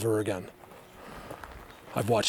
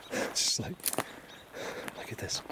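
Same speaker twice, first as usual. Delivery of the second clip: breathy hushed whisper; 3 semitones lower, 10 dB quieter than usual. Real, not acted. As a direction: keep it under your breath. Whispered aside while hiding.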